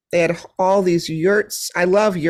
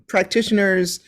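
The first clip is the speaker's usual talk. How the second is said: ordinary delivery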